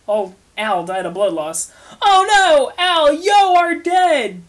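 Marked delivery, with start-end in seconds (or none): 2.0-4.5 much higher, louder, slow shriek of panic; shrieking hysterically; screaming. Acted. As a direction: scream it like she just found him dead. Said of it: "Triss screamed".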